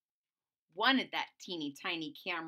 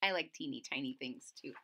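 quiet aside; 9 semitones lower, 5 dB quieter, faster than usual. Real, not acted.

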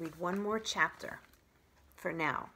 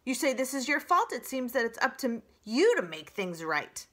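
fast, loud, stern; scolding goose; 4 semitones higher, 7 dB louder than usual. Acted. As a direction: scold the robot briskly.

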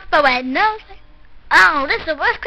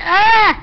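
startled yell; 7 semitones higher, 6 dB louder than usual. Acted. Water poured on head.

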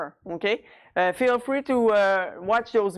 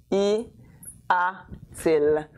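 "y", pause, then "a-t-il"; measured, word-by-word teaching delivery; piece by piece; 2 semitones lower, much slower than usual. Shown as a demonstration. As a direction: separate the pieces for learners. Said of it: "I'm going to use the inversion".

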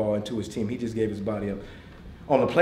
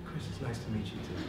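hushed, understated coach's voice; Tony Dungy; 2 semitones lower, 12 dB quieter than usual. Retold or imitated. Quiet coach greeting an admirer, softly imitated. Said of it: "he's a quiet leader".

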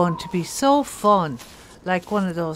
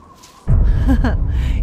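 loud laugh; 5 semitones higher, 11 dB louder than usual. Acted. Laughs off warning.

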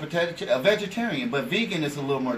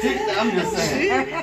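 high, drawn-out singer-like voice with a laugh; 11 semitones higher, 3 dB louder, much slower than usual. Acted. Guest defending his partner's remark, high slow diva voice. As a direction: stretch it out high and playful. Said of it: "he said in his [name] voice".

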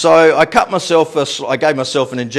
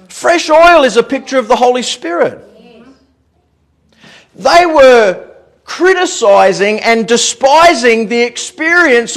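raised, fervent sermon delivery, building in force; preaching; 10 semitones higher, 7 dB louder than usual. Real, not acted. Preacher urging church to experience the living God, fervent preaching. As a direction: drive each point home from the pulpit.

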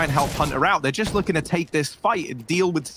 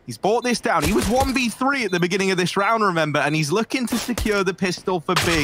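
higher, tense clutch call; excited play-by-play; 5 semitones higher than usual. Real, not acted. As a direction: build tension through the clutch.

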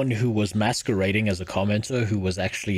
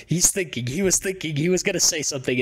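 excited; 7 semitones higher than usual. Real, not acted. Excitedly narrating streamer's pause.

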